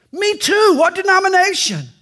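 high thrilled exclamation; delighted, pitch it way up; excited. Acted. Rescuer thrilled they share a faith, high excited cry.

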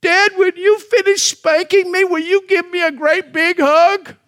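put-on high, pleading voice of a small child; quivering little boy. Five-year-old son asks dad before a spanking, quivering high voice. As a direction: imitate a tearful little boy asking dad. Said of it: "with his little quivering voice".

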